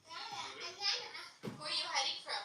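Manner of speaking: loud call across the house. Real, not acted. Calling for hiding child.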